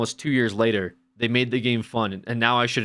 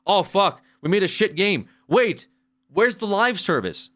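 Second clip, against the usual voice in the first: raised, louder mock panic, Oh fuck; mock panicked developer; 8 semitones higher, 4 dB louder than usual. Mocking game devs who forgot live service, in a raised panicky voice. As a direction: play a dev suddenly realizing the flop.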